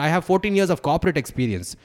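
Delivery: high, loud and fast boast; retold shouting. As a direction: snap back with your credentials. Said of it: "it was an absolute shouting match".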